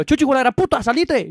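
yelling at the dog. Retold or imitated. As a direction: shout at the dog to get out. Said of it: "cuando le digo".

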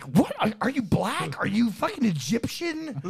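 high, slow, baffled string of questions. Retold or imitated. Mocking confusion about the influencer's accent, high and baffled. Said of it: "And you're like".

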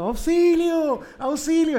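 high, loud cry of auxilio; shouting auxilio as a kid chasing the thief; retold yell for help. Boy chasing his bike thief, yelling for help.

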